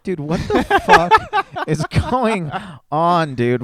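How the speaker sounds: high, loud, drawn-out disbelief; incredulous outburst. Retold or imitated. Fan reacting to the comedian's apology, incredulous. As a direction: stretch it out slowly, high and loud, flabbergasted.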